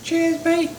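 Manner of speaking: put-on British accent. Toasting drink in funny voice.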